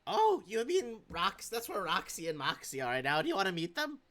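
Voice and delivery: high, excited host voice; high-pitched, eager invitation; eager creature offering introductions. Acted. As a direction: pitch it up, keen to show off friends. Game master voices eager character.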